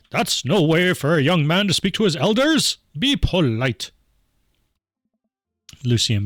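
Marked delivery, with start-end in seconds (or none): 0.1-3.9 louder, theatrical parental reprimand; scolding father. Acted. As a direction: lecture a rude son grandly. Gothic father scolds son.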